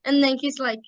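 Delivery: ordinary talk, nothing marked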